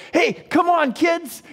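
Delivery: put-on high, loud call to the kids; mock-excited parent calling out. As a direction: hurry the kids along, cheerfully. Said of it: "the parents are going".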